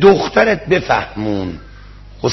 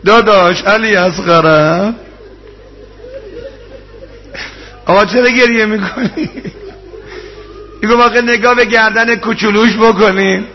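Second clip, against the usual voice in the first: raised, tearful rowze recital to the congregation; mourning sermon; 5 semitones higher than usual. Acted. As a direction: preach the tragedy at full force, voice straining. Rowze mourning recital at full force.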